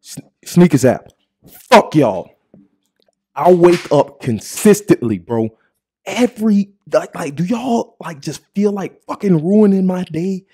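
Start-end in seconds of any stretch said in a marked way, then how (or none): 0.0-7.3 loud furious yell at the app; angry shout. Real, not acted. Ranting at the Sneakers app, shouting it out loud. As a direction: let the app have it at full volume. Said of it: "so y'all can really feel me on this".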